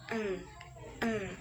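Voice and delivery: eng as an isolated nasal "ng"; N and G joined into one hum; ng sound demo. Shown as a demonstration. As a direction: sound out eng, twice with a pause. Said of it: "itu digabung".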